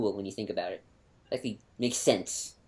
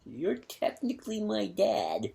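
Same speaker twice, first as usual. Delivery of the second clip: slow, high and softer; awkward admission; 8 semitones higher, 6 dB quieter, slower than usual. Acted. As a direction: draw it out as an awkward confession. Vader in awkward father relationship.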